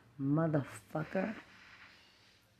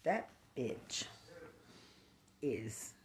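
quiet seething; 4 semitones lower, 10 dB quieter, much slower than usual. Real, not acted. Drops to quiet.

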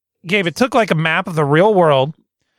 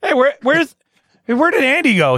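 calling out; 9 semitones higher than usual. Retold or imitated. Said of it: "Gabe's, like".

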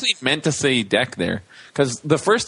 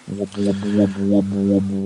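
low monotone run of whoops; low-pitched repetitive whoop noise; imitated whooping; 4 semitones lower, slower than usual. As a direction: drone out whoops in a steady rhythm.

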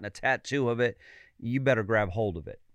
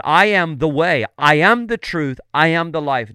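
emphatic declaration; 3 semitones higher, 10 dB louder than usual. Retold or imitated. Quoting Jesus's declaration emphatically.

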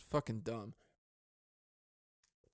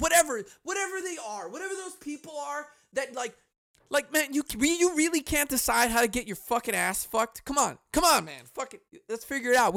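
mock ranting voter; 8 semitones higher than usual. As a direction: sneer at the undecided voters.

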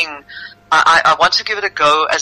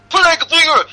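high-pitched accusing shout; 4 dB louder than usual. Acted. Merchant accuses the unmasked stranger, shouting. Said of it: "shouted the merchant".

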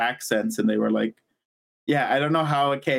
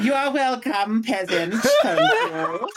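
high, drawn-out English queen voice; grand, high-pitched royal address with laughter; Queen of England; 6 semitones higher, much slower than usual. Acted. Guest showing hosts their drunken Queen, regal high voice. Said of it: "I become the Queen of England".

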